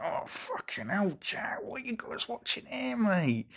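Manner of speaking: high, mock-shocked voice of his own stomach; stomach talking. His stomach protesting at the film, high and incredulous. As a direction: squeak it out as a horrified gut. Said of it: "my stomach was like".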